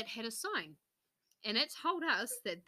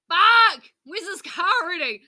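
high, loud cursing outburst; loud exasperated swearing; frustrated shout; 9 semitones higher, 17 dB louder than usual. Retold or imitated. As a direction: yell in frustration. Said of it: "And then it's like".

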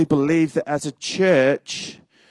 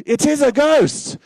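high, loud frightened shriek; terrified cry; 11 semitones higher, 6 dB louder than usual. Acted. Disciples seeing a figure on the sea, terrified shriek. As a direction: cry it out in terror. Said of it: "they were terrified and said".

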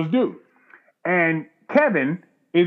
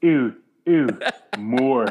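low, spaced-out mock Canadian moans; Canadian lumberjack moan; 5 semitones lower, much slower than usual. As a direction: imitate unromantic Canadian moaning. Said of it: "it's a lumberjack doing whatever".

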